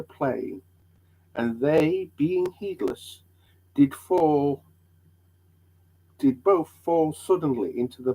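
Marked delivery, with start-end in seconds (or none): none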